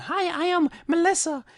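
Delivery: put-on high, light girlish reply; girl's reply. Coach voicing the girl who answers, high and girlish. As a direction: answer brightly like a girl being approached. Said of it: "She's respond".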